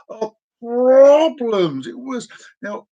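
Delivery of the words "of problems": "problems" drawn out long, high and loud; mock-dramatic emphasis. Recalling boxes nearly falling, mock-dramatic "problems" drawn out. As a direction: stretch "problems" with a rolled-out start, louder.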